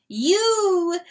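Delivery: high ringing call; crowing. Acted. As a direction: crow it to the herd. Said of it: "crowed the rooster".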